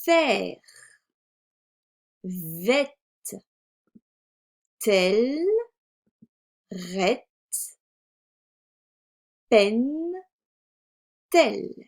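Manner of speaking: isolated syllables with long pauses; reading syllables aloud. Shown as a demonstration. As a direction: model each syllable distinctly for children. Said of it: "commencer par lire les syllabes". (lecture de syllabes, bien détachées)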